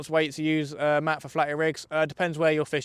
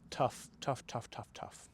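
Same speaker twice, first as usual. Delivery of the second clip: fading to a whisper; 13 dB quieter, slower than usual. Real, not acted. Fading out after losing fish.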